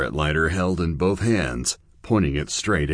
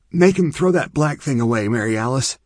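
put-on higher, louder urgent girl's voice; urgent plea; 9 semitones higher, 5 dB louder than usual. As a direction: push her to act now. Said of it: "Chia urged".

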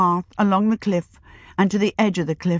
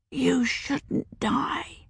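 put-on slow, soft child's whisper; child whispering gently at a sickbed; 6 semitones higher, 7 dB quieter, much slower than usual. A narrator's reading. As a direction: hush it to the dying woman. Child answers dying witch softly.

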